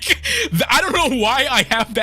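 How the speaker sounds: high, laugh-filled protest. Real, not acted. Streamer confessing a silly fear to viewers, laughing. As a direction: laugh at yourself as you explain. Laughs at own insecure thought.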